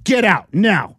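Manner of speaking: firm mock military command; stormtrooper order. Stormtrooper ordering riders out, imitated stern command. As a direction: order prisoners out, each word stressed. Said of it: "'Cause they were in character".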